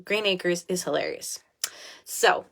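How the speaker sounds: usual speaking voice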